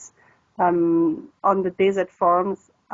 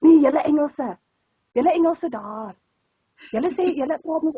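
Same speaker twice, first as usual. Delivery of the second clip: higher, put-on voice of an insistent auntie; 7 semitones higher than usual. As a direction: imitate a stubborn older lady scolding the English. Retold lady insisting he's English.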